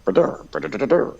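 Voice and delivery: sung-out "ba-dur" riff; vocalized guitar riff. Shown as a demonstration. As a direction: sound out a guitar rhythm with your mouth. Sounding out guitar rhythm.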